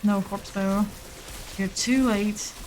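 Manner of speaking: ordinary talk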